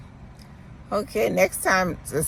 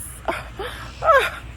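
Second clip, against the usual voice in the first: high, breathless half-cry laugh; teary laugh. Real, not acted. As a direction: laugh that tips into crying. Overwhelmed with emotion.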